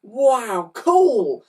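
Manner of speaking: high, loud delighted cheer; shouting. A narrator's reading. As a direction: kids thrilled with loot. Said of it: "shouted the children".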